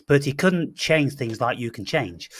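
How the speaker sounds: usual speaking voice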